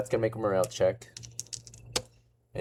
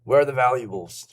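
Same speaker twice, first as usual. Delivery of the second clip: captain yelling; 4 semitones higher, 5 dB louder than usual. Acted. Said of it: "yelling at her".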